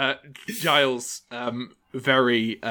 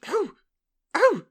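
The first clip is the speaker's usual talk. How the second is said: rising high, louder excited oh; delighted gasps; 5 dB louder, slower than usual. Acted. Old gentleman thrilled at the invitation. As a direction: burst with delight. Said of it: "delighted old man".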